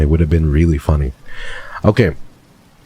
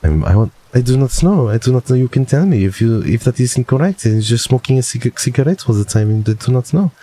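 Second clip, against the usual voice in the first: higher put-on French voice; flustered mock French rambling; French accent; 4 semitones higher than usual. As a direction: imitate a French speaker, pitched up. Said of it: "is that how you sound".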